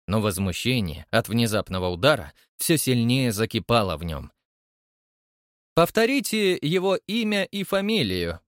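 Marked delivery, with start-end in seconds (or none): 5.7-8.4 lightly voiced stern chairman, higher; demanding it like a strict old chairman. A narrator's reading. Chairman to Krymov, a stern demand. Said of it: "потребовал строгий председатель".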